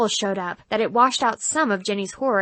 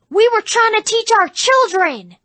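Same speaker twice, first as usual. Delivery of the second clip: very high, loud furious scream; screaming mother; 12 semitones higher, 8 dB louder than usual. Retold or imitated. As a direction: scream at them across the lot. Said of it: "she screamed".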